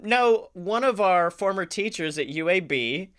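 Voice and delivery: normal talking voice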